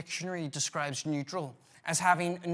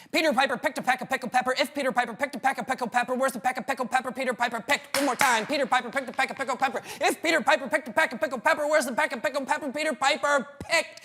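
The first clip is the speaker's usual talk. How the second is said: rattled off even faster; quicker tongue twister; 6 semitones higher, 5 dB louder, much faster than usual. Shown as a demonstration. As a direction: rush it as fast as you can. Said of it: "but quicker".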